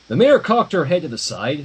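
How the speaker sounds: ordinary delivery, nothing marked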